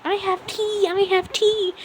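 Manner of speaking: high sing-song mock excitement; gleeful gossip. Acid girl teasing classmates with gossip, high and gleeful. Giggling gossip announces her news.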